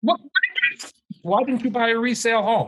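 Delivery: high, fast exasperated outburst; real heated yelling. Real, not acted. Co-host berating the complaining buyer of a new home, heated yell. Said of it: "You can't yell into your microphone".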